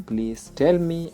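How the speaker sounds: ordinary delivery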